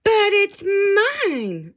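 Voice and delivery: very high, loud, slow screech; screamed. A narrator's reading. Maisie claiming the egg, high slow scream. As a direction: stretch out a shrill demand. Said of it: "screamed the bird".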